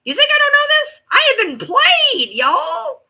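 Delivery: high, loud exasperated yelling; exasperated shout. Real, not acted. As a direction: vent it at full volume. Ranting about being played.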